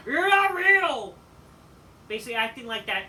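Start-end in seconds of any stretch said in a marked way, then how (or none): 0.1-1.1 slow, high, loud shouted accusation; mock yell. Fan imitating Miro yelling at Lana, hammed-up slow yell. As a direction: bellow it at her, dragging it out. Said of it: "He's like".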